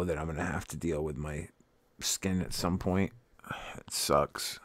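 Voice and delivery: hushed, breathy whisper, slowing down; whispered resignation. Real, not acted. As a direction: drop to a whisper as if it hurts to say. Sighs about his future loose skin.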